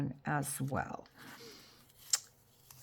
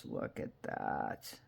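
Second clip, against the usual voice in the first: low awed whisper; 9 dB quieter than usual. Real, not acted. Crafter admiring her fabric to viewers, whispered. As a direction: hush it like a secret treasure.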